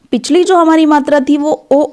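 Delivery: usual speaking voice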